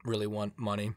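hoarse, weak voice from a cold; tired sick voice trailing off. Real, not acted. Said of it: "I'm sick".

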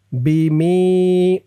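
slow "বিমি" with the final i held long; one-alif stretch. Shown as a demonstration. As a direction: draw out the end of "বিমি". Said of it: "মি এক আলিফ টান". ("বিমি"-র মি এক আলিফ টেনে)

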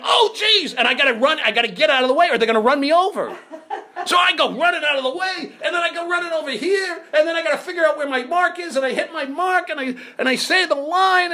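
high, frantic comic scramble; mock panic. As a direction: yelp it out in a breathless-sounding rush. Acting his scramble from the cameras.

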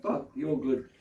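hushed, tender reassurance; soothing. Acted. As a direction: soothe a child mid-hug, softly. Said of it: "they just need a bit of a hug".

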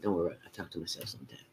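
fast unvoiced confiding whisper; rushed whispered aside; quick whisper. Real, not acted. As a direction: share a secret aside.

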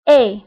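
vowel sound E clearly. Shown as a demonstration. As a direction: say إي as a clean French e. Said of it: "أيضًا كيتنطق بإي".

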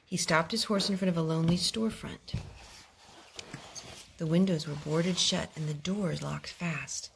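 plain reading voice

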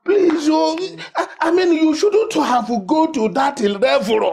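high-pitched remonstrance; pleading protest. Acted. Elder begs someone who went too far, pleading.

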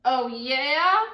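loud sneering snarl. A narrator's reading. Not Nice Nellie snarling back at Miss Grace, defiant. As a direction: challenge her with a sneer. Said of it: "snarled Not Nice Nellie".

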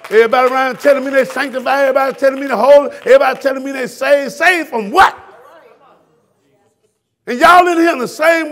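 rising fervent sermon shout; preaching at full force. Real, not acted. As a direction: preach it hard to the room.

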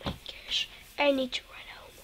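urgent whisper. Real, not acted. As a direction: whisper it in a hurry.

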